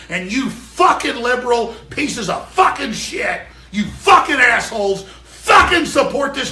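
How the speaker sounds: screaming abuse, loud and high; furious ranting, punching each swear word; real enraged tirade. Real, not acted. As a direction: hurl every insult at full shout. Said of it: "now I'm fucking pissed right now".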